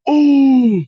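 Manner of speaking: loud, high stadium oh; like fans seeing a big tackle; crowd wince. Retold or imitated. Football crowd reacting to a hard hit, loud high oh. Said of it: "That's exactly how they sounded".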